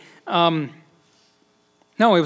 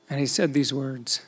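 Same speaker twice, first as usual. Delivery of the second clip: ordinary talk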